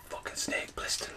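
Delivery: seeing the figure, whispered amazement; whispered awe. Retold or imitated. Whispered reaction in the shop.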